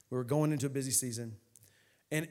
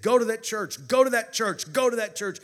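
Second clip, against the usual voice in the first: loud, higher, repeated command; insistent voice; 6 semitones higher, 8 dB louder than usual. Retold or imitated. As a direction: hammer out each repeat as an urgent order. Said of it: "That's what I kept hearing in my car".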